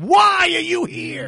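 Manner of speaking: drawn-out, high-pitched yell; full-throated angry holler, stretched out; mock parental shout. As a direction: bellow it slowly like a furious parent. Acting out a parent shaking kids.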